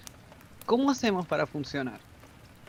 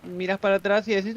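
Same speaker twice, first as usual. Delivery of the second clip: ordinary talk, nothing marked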